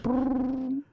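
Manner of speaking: high rolled ring sound; phone ring. Shown as a demonstration. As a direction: imitate a phone ringing. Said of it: "the beginning of the phone call".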